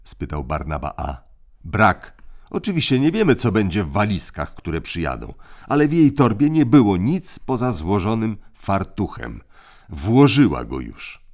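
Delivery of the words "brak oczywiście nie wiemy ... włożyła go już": put-on raised, commanding boy's voice; crisp orders from the eldest; bossy older brother. A narrator's reading. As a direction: lay down the rules like a little tyrant. Tim reporting.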